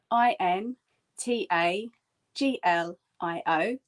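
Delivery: letter by letter, "I N, T A, G L, I O"; "I N T A G L I O" as separate letter names in measured pairs; spelling out. Shown as a demonstration. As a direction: spell "I N T A G L I O" in pairs, pausing between them. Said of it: "the word is spelt".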